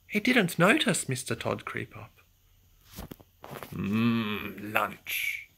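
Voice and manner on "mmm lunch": lower, hungry fox purring over food; hungry fox hiss. Acted. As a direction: savour it like a sly hungry fox. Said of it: "hissed the hungry fox".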